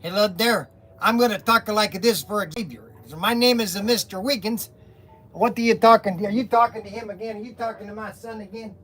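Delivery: put-on comic character with a foreign accent; Mister Wiggins. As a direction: play a sketch-show boss with a thick comic accent. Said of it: "Tim Conway did on the Carol Burnett show was Mister Wiggins".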